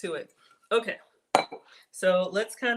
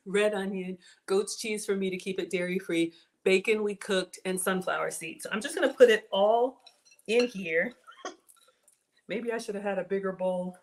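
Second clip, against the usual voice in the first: ordinary delivery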